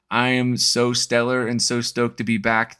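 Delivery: usual speaking voice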